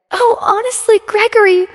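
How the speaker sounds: high-pitched dismissive mother; high, affected exasperation; exasperated mother. A narrator's reading. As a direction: brush it off in a high, put-on voice. Janet brushes off her son's question.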